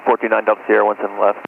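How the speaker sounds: ordinary talk, nothing marked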